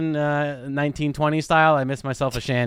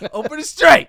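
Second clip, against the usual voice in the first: shouted high and loud; mock shout; 10 semitones higher, 8 dB louder than usual. Joking about the Strait of Hormuz, high mock shout. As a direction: yell it as a goofy punchline.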